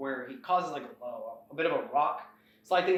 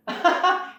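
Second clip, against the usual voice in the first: dismissive laugh; 7 dB louder than usual. Retold or imitated. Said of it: "Mavra just laughs it off".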